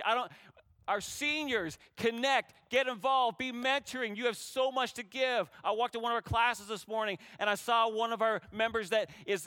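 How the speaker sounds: rally the whole congregation to serve; full-force preaching. Acted.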